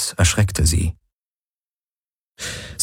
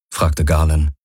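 usual speaking voice

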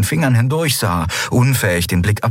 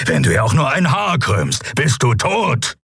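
angry snarl; 5 semitones higher than usual. A narrator's reading. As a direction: threaten the captor in fierce defence of a friend. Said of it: "fauchte Keru". (wütend fauchend, drohend)

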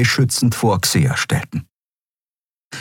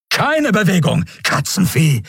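threatening soldier; 12 semitones higher than usual. Acted. Soldier threatens captive at gunpoint.

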